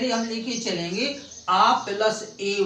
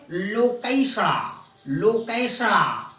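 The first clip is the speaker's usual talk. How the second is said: "लोकेशणा" with a strong, raised ऐ after the क; stress on ऐ; 4 semitones higher, slower than usual. Shown as a demonstration. Teacher giving the second sandhi example, stressed ऐ in "लोकेशणा". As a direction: punch the middle vowel of "लोकेशणा" as ऐ. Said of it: "ऐ की मात्रा से".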